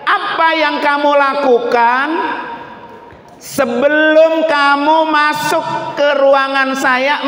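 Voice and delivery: high, emphatic king's demand; questioning king. Acted. Baffled king questions the young man, acted demand.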